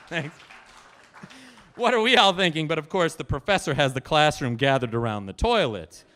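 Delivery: usual speaking voice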